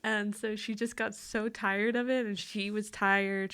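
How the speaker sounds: ordinary delivery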